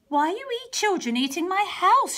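put-on high, sing-song old witch; a sweet false witch luring children. Storyteller voicing the witch to the children, high and sweet.

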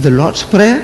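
retold disbelief, "Prayer" lifted at the end; puzzled echo. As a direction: repeat "Prayer" as a baffled question. Retells his puzzled reaction to it.

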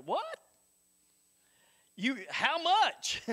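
high, slowed mock shock; retold shock on hearing the tithe; shocked disbelief. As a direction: squeak out a stunned how much.

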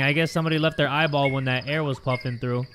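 ordinary talk, nothing marked

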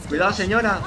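urgent high call-out; shouted warning. Real, not acted. Warns a passer-by in game.

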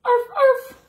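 high, loud single arf; puppy yap. Acted. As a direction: yap once like a tiny pup. Pup barks in picture book.

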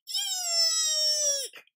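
high squeaky eek; scared shriek. Acted. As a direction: squeal it in fright. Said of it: "yelled Carrie".

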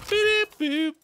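very high beep boop robot voice. Pretend. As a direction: chirp it like a little robot. Robot noises imitating weapon.

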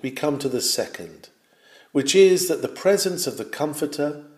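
normal talking voice

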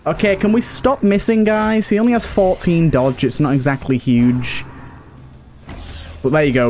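ordinary talk, nothing marked

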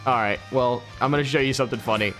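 ordinary delivery

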